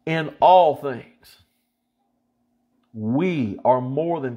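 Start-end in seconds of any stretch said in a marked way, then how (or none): 0.0-1.4 all slow and louder; stress on all. Shown as a demonstration. Preacher stressing all for the listeners. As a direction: dwell on all. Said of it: "underline that word all".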